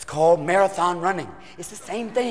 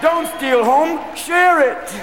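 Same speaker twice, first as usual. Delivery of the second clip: stoned umpire; 6 semitones higher, much slower than usual. Retold or imitated. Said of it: "umpire going".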